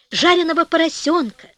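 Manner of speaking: ordinary delivery, nothing marked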